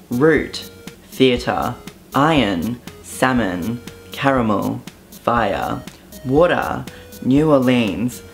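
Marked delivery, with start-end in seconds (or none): none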